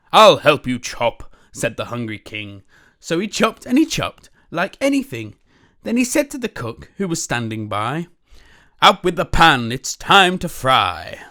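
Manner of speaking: lively, loud read-aloud storytelling; animated picture-book reading; story narration. A narrator's reading. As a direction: read it big and bouncy for kids.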